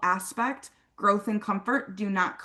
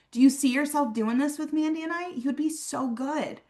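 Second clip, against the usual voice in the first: upbeat confident ask; 5 semitones higher than usual. Shown as a demonstration. Recruiter asking a guest to join, confident and high. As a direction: ask it like you know they'll love it. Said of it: "walk up to Mandy and her cousin and be like".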